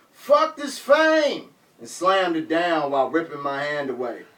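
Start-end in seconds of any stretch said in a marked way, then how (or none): 0.1-1.5 slow, high, drawn-out yell. Retold or imitated. Rapper raising the mic stand overhead, slow yell. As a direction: drag each word out in a furious yell.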